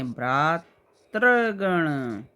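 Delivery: भ्रातृगण stretched very slowly in syllables; slow spelling demo. Shown as a demonstration. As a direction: sound out भ्रातृगण for someone writing it. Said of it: "देखिए कैसे लिखेंगे".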